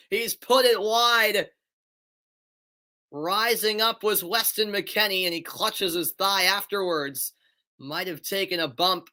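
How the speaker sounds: brisk, lifted match call; play-by-play. Acted. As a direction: call the free kick live.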